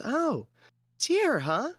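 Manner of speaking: high, hesitant female voice; woman clerk. Acted. Clerk checking his paperwork, high-voiced and uneasy. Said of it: "and she goes".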